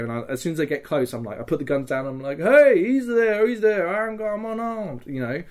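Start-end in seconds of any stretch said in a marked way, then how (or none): 2.4-5.1 high, loud and slow hail; calling out surrender. Retold or imitated. Surrendering player calls out loudly to the enemy that he's unarmed. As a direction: call out that you're unarmed, hands up.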